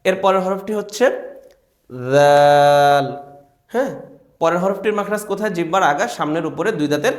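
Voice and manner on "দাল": দাল loud with the vowel held long; modelling দাল once more for the class; stretched letter name. Shown as a demonstration. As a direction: draw out দাল clearly. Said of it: "তিন আলিফ টান দিতে হবে".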